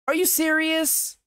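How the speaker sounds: stretched-out, genuine incredulity; incredulous. Real, not acted. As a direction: pitch it way up, aghast. Incredulous reaction to praise of acting.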